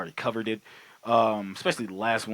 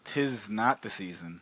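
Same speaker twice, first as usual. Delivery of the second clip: whispering; 6 semitones lower, 4 dB quieter than usual. Pretend. Joins the whisper bit.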